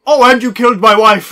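hammed-up accusation, rising and loud; dubbed husband character. Quoting a comic dub to friends, hammed-up husband outburst. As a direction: imitate a bad dub erupting loudly. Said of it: "he done the dubbing".

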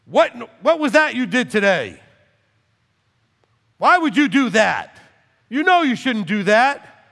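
loud, high, stern rebuke; imitating God scolding someone who disobeyed. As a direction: thunder at the sinner. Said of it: "It would be more like".

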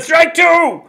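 very high, loud drawn-out call; umpire's call. Acted. Umpire calling the second strike, loud and high. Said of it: "and the umpire said".

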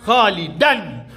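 خالدا drawn out slowly with the tanween ending sounded. Shown as a demonstration. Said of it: "دن". (خالدا ببطء مع نطق التنوين)

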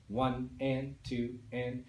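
slow, evenly spaced counting; steady beat count. Shown as a demonstration. Music teacher showing students how to count the beat, slow steady counting. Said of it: "count the beat like this".

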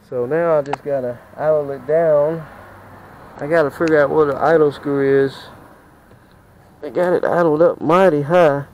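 ordinary talk, nothing marked

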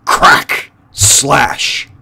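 loud, punchy sound-effect words; sudden sharp outburst; explosive whip cracks. A narrator's reading. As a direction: hit each word like a lash. Narrator acts out whip-tail blows.

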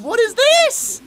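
loud, very high incredulous cry; astonished. Real, not acted. Viewer amazed by the fight, crying out high. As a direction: shriek the question in amazement.

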